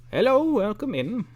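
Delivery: put-on high, bright old shopkeeper lady; squeaky sweet old woman greeting; silly old lady voice. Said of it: "the silly old lady voice".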